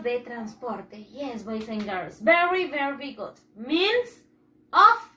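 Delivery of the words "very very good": usual speaking voice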